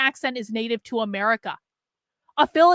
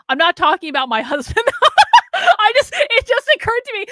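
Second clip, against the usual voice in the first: talking through a burst of laughter, high and loud; helpless laughter breaking into the words; real laughing; 9 semitones higher, 8 dB louder than usual. Real, not acted. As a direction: crack up mid-sentence, voice shooting up. Bursts out laughing at own contradiction.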